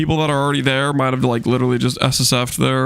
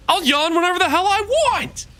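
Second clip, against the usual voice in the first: defiant squeaky voice. Pretend. Defiant reply to viewer.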